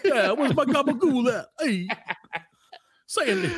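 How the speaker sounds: high, laughing Italian-American greaser; goofy, hammed-up movie greaser voice; John Travolta. Host clowning as Travolta in Grease, mock greaser voice. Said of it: "That was my John Travolta in Grease".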